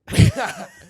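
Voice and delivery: high breathy burst of laughing; airy high laugh; burst of laughter. Real, not acted. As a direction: crack up suddenly.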